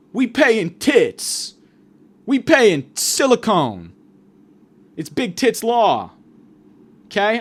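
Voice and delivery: high, loud radio hype voice; shouted pitch with stretched-out slogans; mock law-firm ad. Law firm pitches injured listeners on radio, high hammed-up hype. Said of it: "here's what it's gonna be".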